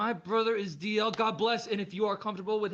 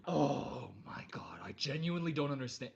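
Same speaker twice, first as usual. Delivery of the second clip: stunned whisper; 6 semitones lower, 6 dB quieter than usual. Real, not acted. Shocked whisper.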